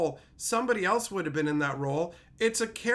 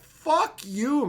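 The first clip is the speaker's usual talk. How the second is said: ordinary talk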